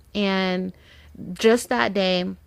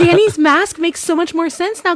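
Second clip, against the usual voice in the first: much higher, lively aha; excited realization; 9 semitones higher, 9 dB louder than usual. Retold or imitated. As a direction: deliver it like a sudden revelation. Retelling what she said.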